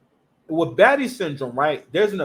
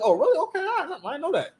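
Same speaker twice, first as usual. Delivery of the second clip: naive surprise; 9 semitones higher, faster than usual. Retold or imitated. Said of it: "you would hear that and be like".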